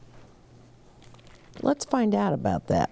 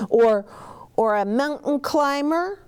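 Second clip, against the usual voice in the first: lightly voiced child; 10 semitones higher than usual.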